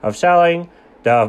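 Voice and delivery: slow, drawn-out speechifying; pompous salesman milking the moment; dragging it out. Retold or imitated. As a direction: stretch every word like a windbag. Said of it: "Come on, buddy, get on with it".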